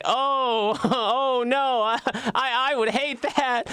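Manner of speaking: hammed-up "oh no"; mock dismay. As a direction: feign dismay while barely hiding the joke. Sarcastic reply to a repeat scan.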